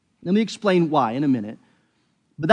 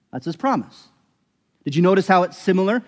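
normal talking voice

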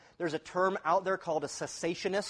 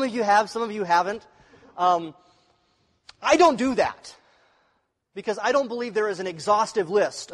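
preaching with force; 4 semitones higher, 6 dB louder than usual. Acted. Preaching loudly to congregation.

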